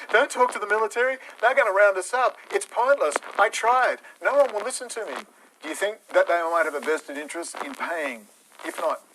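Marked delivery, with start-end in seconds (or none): 0.1-5.1 high, mocking defeatist whine; mocking whiny operator. Ranter mocking demoralisers with a high whine. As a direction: mimic a hopeless whiner, pitched up high. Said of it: "run around from Denology going".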